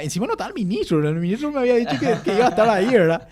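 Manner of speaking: worked-up outrage. Retold or imitated. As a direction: pile on the outrage, speeding up. Indignant retelling, minister absent.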